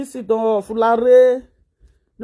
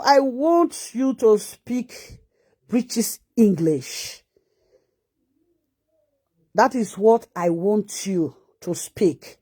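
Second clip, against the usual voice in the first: measured words with pauses, dropping lower; slow, deliberate demand; 3 semitones lower, slower than usual. Real, not acted. Telling the sister to speak British English, slow and deliberate. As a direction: spell it out slowly, word by word.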